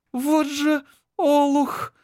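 высоко и медленно, сквозь зубы; цедит сквозь зубы злобно; through gritted teeth. Acted. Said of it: "Сквозь зубы цедит Анжела". (цедит сквозь зубы)

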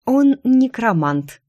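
slow, prim correcting tone; pedantic correction. Acted. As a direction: correct him like a know-it-all. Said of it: "педантично поправила я в ответ".

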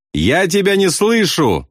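high, stretched-out shout without much volume; shout in the ear. A narrator's reading. Bombardier to pilot over engine roar, high shout. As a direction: call it slowly on a high held pitch. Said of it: "крикнул он ему в ухо".

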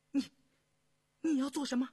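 high, loud, alarmed question; startled. A narrator's reading. Yindi backing away from Yongzheng on seeing him, startled. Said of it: "他惊怔地后退了一步".

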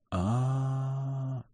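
long soft drawn-out ah. A narrator's reading. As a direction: stretch the ah out quietly. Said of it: "протянул Миха".

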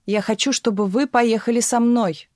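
mild, contained shout; crying out. A narrator's reading. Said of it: "крикнул барон".

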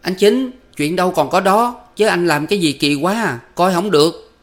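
high-pitched, hasty remonstrance; hurried protest. A narrator's reading. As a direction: jump in to reason with him. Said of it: "vội vã nói với hắn ta".

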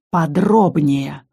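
slow menacing hiss. A narrator's reading. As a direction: hiss it right in her face. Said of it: "прошипел он мне в лицо". (прошипел медленно и угрожающе)

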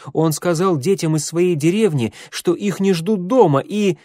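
high-pitched, urgent tattling; worked-up protest, left hanging; indignant accusation. A narrator's reading.

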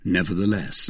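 usual speaking voice

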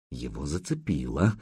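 soft, low inner voice; inner thought. Acted. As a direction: think it quietly to yourself. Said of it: "подумал Духарев".

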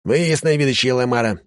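a pompous lackey giving orders; haughty servant. Acted. Servant voice in audiobook.

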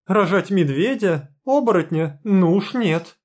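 high, loud squeal of protest; shrieking. A narrator's reading. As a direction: shriek it out in horror. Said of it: "взвизгнула Алина".

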